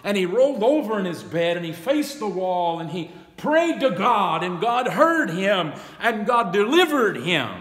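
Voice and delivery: whooping preacher crescendo; full-force preaching. Acted. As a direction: build the congregation up to the peak. Preacher building to a climax.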